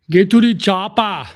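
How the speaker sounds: hint of a movie action hero barking an order; Arnold impression. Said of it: "Can you do an Arnold voice?".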